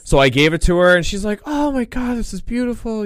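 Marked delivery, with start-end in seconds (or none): 1.4-3.0 put-on high, soft gushing older mother; mock maternal delight; mom voice. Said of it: "it's just my mom voice".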